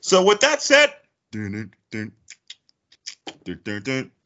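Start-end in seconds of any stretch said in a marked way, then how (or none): 1.3-4.1 dun-dun jingle sting. Pretend. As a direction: voice a dramatic show jingle, softly. Mimicking a courtroom show jingle.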